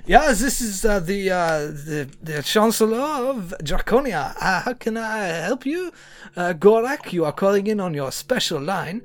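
put-on improvised French-accented chancellor. Chancellor of Draconia taking a special-line call, French accent. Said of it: "I'm going French again".

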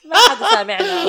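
loud laughing words; talking through laughter. Real, not acted. Joking about copyright. (كلام مع ضحك)

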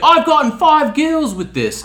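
high, loud imitation of bragging; retold loud boast. Cop boasting about his prank, loud and high mock brag.

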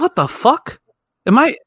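high, startled disbelief; shocked outburst. Retold or imitated. Reacting to the tattoo artist, shocked and high. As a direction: jump up in pitch, freaked out. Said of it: "So I was just like".

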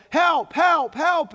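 high, loud panicked shout; yelling for help. Acted. Man in gorilla suit yelling for help under lion, loud panicked shout. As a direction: cry out for help at full voice. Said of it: "and he yells".

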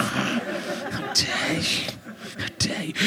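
mock outrage.

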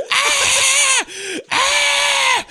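long, very high mimicked shriek; mock screaming. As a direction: scream like a hysterical film character. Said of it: "she's just going".

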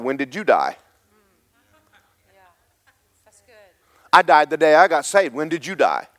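loud, high, driving sermon voice; fiery revival preacher; preaching at full force. Acted. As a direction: preach it hard to the room.

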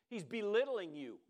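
usual speaking voice

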